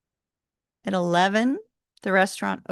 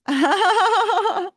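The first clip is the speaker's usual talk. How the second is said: laugh; 12 semitones higher, 7 dB louder than usual. Real, not acted. Host laughing at chat.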